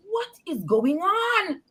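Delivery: slow, high-pitched retold bewilderment; bewildered demand. Woman among bawling callers, slow high bewildered question. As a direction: stretch it out high in disbelief. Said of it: "So I said".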